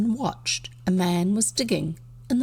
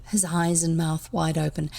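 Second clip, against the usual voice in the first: plain reading voice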